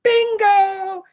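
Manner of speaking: mock bingo yell. Bingo player calling out a win, loud yell. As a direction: shout it like the winner across the hall. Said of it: "somebody will yell".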